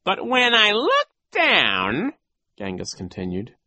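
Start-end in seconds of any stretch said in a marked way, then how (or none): 0.0-2.1 high, slow, loud villain voice; oily mock bafflement; sly villain coach. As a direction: feign confusion, drawn out and high. Voices Genghis.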